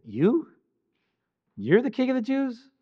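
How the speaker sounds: high, mocking disbelief; sneering, incredulous Pilate; sarcastic. Shown as a demonstration. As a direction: scoff at the idea of this man as king. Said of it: "Pilate is being a bit sarcastic".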